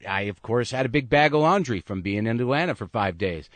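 ordinary delivery

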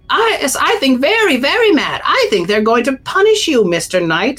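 high, mock-ominous bird voice; the bird piling on with a teasing warning. Missus Bird warning the knight of punishment, high bird voice. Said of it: "says Missus Bird".

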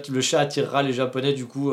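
ordinary talk

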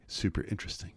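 very soft, near-whispered; hushed, understated quote; dry quoted aside. Retold or imitated. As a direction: drop it almost to a whisper. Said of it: "he says".

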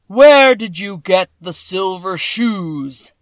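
a vast, slow, thundering wizard; voice of the great Oz. A narrator's reading. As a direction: boom each word out like a huge magic head. Oz voice again.

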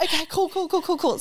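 high repeated nervous cools. Retold or imitated. Psyching herself up before the pipe, high nervous chatter. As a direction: rattle them off high and jittery.

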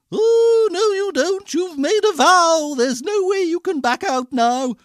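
very high, loud indignant shriek; high-pitched bossy storybook sister; ugly sister. A narrator's reading. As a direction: scold the prince in a shrill, insistent voice. Ugly sister argues with prince.

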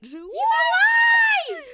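high, loud, drawn-out slow shout; mock shout. Cheering that Bryant is back, in a high, slow, drawn-out shout. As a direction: announce it like a horror movie. Joke about dead boyfriend.

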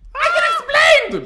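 very high, loud mock shriek; panicked scream. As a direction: shriek your excuse when caught red-handed. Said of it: "Jag skriker".

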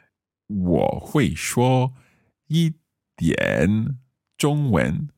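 slow, syllable by syllable 我会说一点中文; careful learner's Mandarin. Shown as a demonstration. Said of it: "Right, so".

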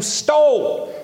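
preacher thunders at the thief; outraged shout. Real, not acted. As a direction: shout it up in disbelief. Preacher erupts over theft.

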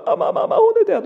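high, loud wail of panic; acted child in distress; panicked child. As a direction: imitate a frantic boy gabbling for mum. Son's panicked phone call.

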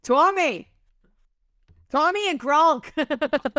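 hammy Boston sports-fan accent. Shown as a demonstration. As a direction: holler names in a broad Boston accent. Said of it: "do a Boston accent".

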